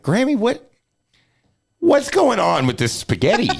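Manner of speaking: high-pitched bewildered questioning; mock bewilderment. Grandson asking grandma about spaghetti, high mock bewilderment.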